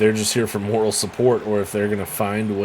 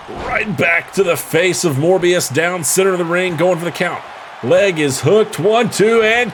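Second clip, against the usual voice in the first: building higher and louder to the count; excited count; 7 semitones higher, 4 dB louder than usual. Real, not acted. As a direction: ramp up the call into the count. Calling a pin count.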